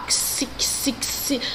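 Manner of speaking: fluister xi, xi, xi; whispered xi sounds. Shown as a demonstration. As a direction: breathe out the ks. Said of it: "o som de C S".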